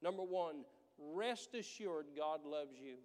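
normal talking voice

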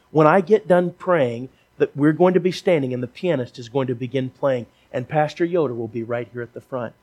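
preacher urging listeners forward to be saved; fervent preaching. Real, not acted. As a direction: drive the altar call home with force. Altar call at full force.

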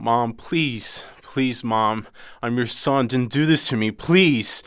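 frightened plea to mom, building to a high cry; desperate pleading. Retold or imitated. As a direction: beg your mother to stop, rising in panic. Son begging mother to stop.

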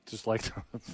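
laughing. Real, not acted. Laughing at fired coach joke.

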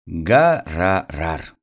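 low, drawn-out engine rumble; imitated car engine. A narrator's reading. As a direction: lightly play a car revving, slowly. Poem's car noise as title.

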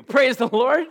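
normal talking voice

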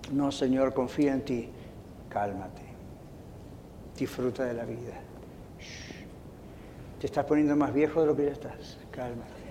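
soft, low, unhurried voice; hushed low reassurance; subdued and soothing. Retold or imitated. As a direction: settle right down, calming gently. Humbled reply, God soothing.

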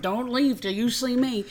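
mock a Boston car dealer, loud and slow; hard Boston accent. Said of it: "it's a hard Boston".

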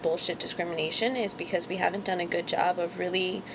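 normal talking voice

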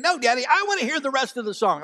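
put-on high, fast, pleading child's voice; insistent child. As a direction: whine it like a determined little boy. Son insists again.

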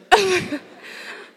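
a burst of high giggling laughter; high delighted laugh. Real, not acted. Retelling the vineyard moment, she laughs high. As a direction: let the laugh break out high.

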